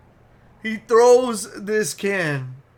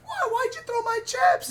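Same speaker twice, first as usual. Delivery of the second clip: whiny high-pitched mini tantrum; very high, petulant imitation; mock little fit. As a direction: squeal it like someone throwing a fit. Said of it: "He's like having a little fit".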